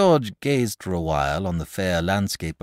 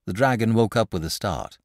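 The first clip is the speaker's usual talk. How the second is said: ordinary delivery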